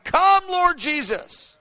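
high, slow cry; impassioned pleading cry. Retold or imitated. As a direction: lift it up slowly as a plea. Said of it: "The Holy Spirit's crying".

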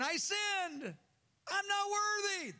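very high, strained cry of confession; pleading son. Acted. Son repeats his confession.

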